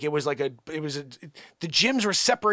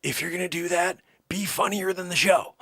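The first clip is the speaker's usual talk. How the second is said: ordinary talk, nothing marked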